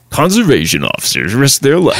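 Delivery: lightly put-on show narrator voice; a hint of a TV voiceover announcer; imitated voiceover guy. Co-host mimicking the show's voiceover guy, lightly hammed-up announcer. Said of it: "the deep voice".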